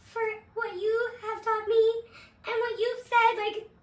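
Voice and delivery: high, slow, breathy fan gratitude; high sweet thank-you of adoring fans; grateful girls' voice. Retold or imitated. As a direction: lift it up high like starstruck young girls. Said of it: "and they're like".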